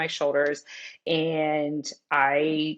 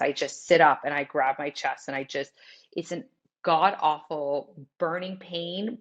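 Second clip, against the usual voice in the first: usual speaking voice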